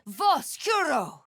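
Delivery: high, slow commanding spell cry; shouted incantation. Acted. Cleric calling out a spell, high slow cry. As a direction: hurl the word out as a spell.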